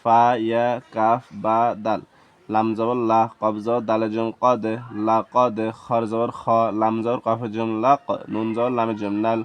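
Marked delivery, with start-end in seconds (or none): none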